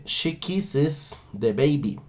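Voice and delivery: slow, careful "kisses" for learners; slow model sentence. Shown as a demonstration. Teacher first models the sentence slowly and carefully. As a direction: dictate it slowly. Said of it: "yo diré".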